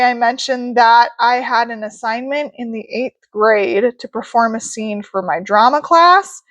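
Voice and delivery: ordinary delivery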